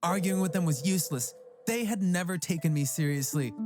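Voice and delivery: plain reading voice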